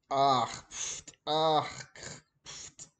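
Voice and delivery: snore it out, ah then pfft; snoring. Retold or imitated. Teacher snoring aloud as Granny for the class.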